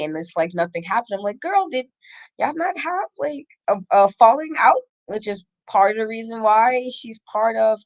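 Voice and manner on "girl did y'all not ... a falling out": much higher, sassy disbelief; sassy doubting question to a friend; incredulous girl. Retold or imitated. Host voices her own incredulous reaction.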